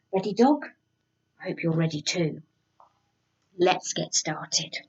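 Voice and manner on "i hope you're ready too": ordinary delivery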